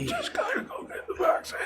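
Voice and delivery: high, soft squeak breaking into laughter; laughing squeak. Retold or imitated. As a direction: try to say it through laughter.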